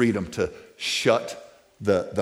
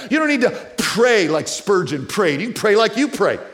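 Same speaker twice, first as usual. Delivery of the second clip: high, loud, ringing preacher's cry; rising preaching at full throttle; full-force preaching; 9 semitones higher, 7 dB louder than usual. Real, not acted. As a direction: lift the room with wonder and conviction.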